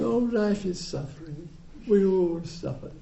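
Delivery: lightly mocking, high and soft; mock platitude. Teacher mocking a glib cliché, high and soft. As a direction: parrot the cliché with a light sneer. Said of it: "They're not saying".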